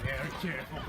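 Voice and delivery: quiet stalking whisper-like voice; hushed. Acted. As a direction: keep it hushed while sneaking. Hushed sneaking narration.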